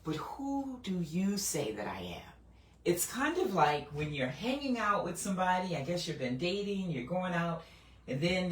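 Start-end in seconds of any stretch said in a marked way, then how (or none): 0.0-2.3 slow, soft, searching question; Jesus asking. Retold or imitated. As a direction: ask it gently and slowly, as Jesus probing his disciples. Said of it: "the way that he asked the question".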